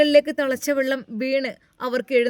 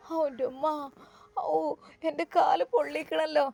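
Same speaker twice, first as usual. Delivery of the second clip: pained moaning; 3 semitones higher, 6 dB quieter than usual. Acted. Scalded grandmother moaning softly in pain. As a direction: moan it out like the burn stings.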